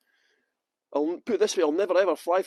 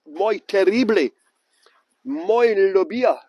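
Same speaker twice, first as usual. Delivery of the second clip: louder, slow, hammed-up Spanish; showing off Spanish; 8 dB louder, slower than usual. Boasting to the chat about the weather, in loud mock Spanish. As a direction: show off your tourist Spanish, slowly and loud. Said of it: "I bet you didn't think I was multi-talented".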